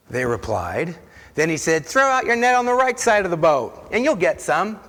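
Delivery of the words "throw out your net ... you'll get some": raised, higher call to the boat. Acted. Jesus calling to disciples in the boat, raised call. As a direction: shout the instruction across the water.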